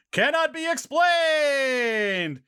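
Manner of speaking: slow dramatic trailer read. Acted. Reading blurb dramatically.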